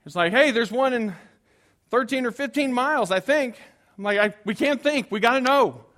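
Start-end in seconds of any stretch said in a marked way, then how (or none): 0.3-3.6 excited answer. Retold or imitated. Passenger answering with GPS info. 4.2-5.8 higher, louder anxious insistence; frantic. Retold or imitated. Pastor retelling his panicked reply to his family. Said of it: "my franticness and anxiety".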